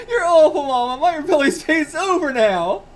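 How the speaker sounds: high, loud mock-crying girl; crying daughter. Daughter wailing at her mother, high mock-crying shout. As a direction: wail at mama, laughing through it.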